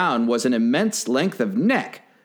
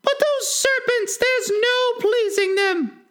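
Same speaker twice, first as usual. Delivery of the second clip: very high, slow fretful bird; plaintive pigeon; slower than usual. A narrator's reading. Pigeon lamenting its nesting troubles, high slow fretful voice. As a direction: list woes in a drawn-out, piercingly high voice. Pigeon complaining on about serpents.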